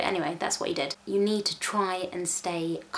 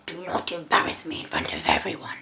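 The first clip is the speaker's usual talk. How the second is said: put-on low, whispered telling-off of a child; mum's stern warning under her breath; hissed warning; 3 semitones lower than usual. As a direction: whisper it as a tight, private reprimand. Tense warning to child during tantrum.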